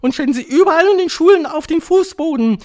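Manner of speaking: high, loud ranting witch; piercing, wild proclamation; frenzied Grand High Witch. A narrator's reading. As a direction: shout it gleefully as a witch leader, building to a yell.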